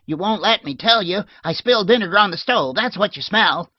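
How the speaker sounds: high, loud, fast protest; exasperated yelling. A narrator's reading. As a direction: yell it through the door. Maid yelling back through door.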